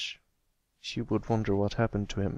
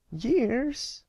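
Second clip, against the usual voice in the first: high, startled rising question; a filly shocked to hear years. Acted. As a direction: gasp it out in dismay. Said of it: "regretted using that word".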